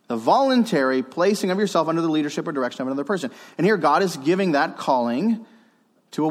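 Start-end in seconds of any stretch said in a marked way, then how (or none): 0.1-3.3 quick run-through of a definition; brisk repeat. Real, not acted. Preacher repeating the definition for listeners, briskly. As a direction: rattle it off as a recap.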